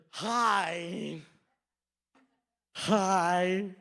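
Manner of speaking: drawn-out greeting through braces; worker in headgear. Retold or imitated. Worker opening the drive-thru window, headgear voice. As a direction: say it round orthodontic headgear. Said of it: "had this whole headgear".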